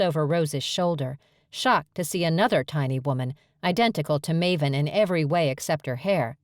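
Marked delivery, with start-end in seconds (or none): none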